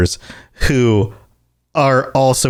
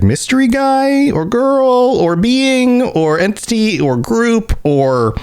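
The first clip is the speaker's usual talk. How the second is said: mock mystery voice; 11 semitones higher, slower than usual. Playful high exaggerated questioning.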